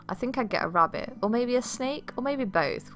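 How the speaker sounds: a lightly voiced daughter pondering pets; musing daughter. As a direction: toss the ideas around, pitch climbing on the snake question.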